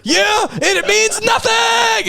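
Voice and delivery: drawn-out, high overacted wail; mock anguish, stretching each word; retold scream. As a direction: repeat it as a hammy stage yell. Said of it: "keeps screaming that line".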